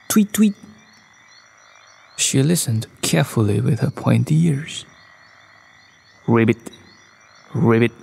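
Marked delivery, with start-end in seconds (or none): 0.1-0.6 high, short owl tweets; owl calling out. A narrator's reading. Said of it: "called out". 6.3-7.9 low, short ribbits; frog croak. A narrator's reading. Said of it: "asked the frog".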